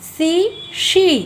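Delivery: सी with s, then शी with sh, slow and clear; teacher sets सी against शी, slow; s versus sh. Shown as a demonstration. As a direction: contrast सी and शी slowly, sounds apart. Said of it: "समझ आ रहा है ना आवाज़".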